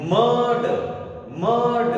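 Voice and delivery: मर्ड said as mad, no r; correct pronunciation. Shown as a demonstration. Teacher giving the correct मर्ड to students. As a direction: keep the r in मर्ड silent. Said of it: "इसका correct pronunciation क्या है?".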